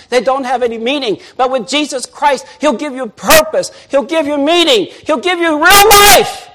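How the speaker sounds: preacher building to a shouted promise; building preaching. Acted. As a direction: build each line until it peaks.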